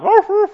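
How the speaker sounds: playful woof woof to announce he's home; imitated dog bark. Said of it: "Stupid dog".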